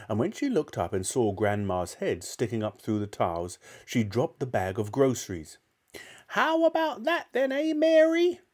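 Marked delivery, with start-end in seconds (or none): 6.3-8.4 put-on high, loud cackling old woman's voice; shouting old grandma. Grandma shouting down at Mary from the roof, high and triumphant. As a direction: crow it down from the rooftop like a wild old granny. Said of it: "Grandma shouted".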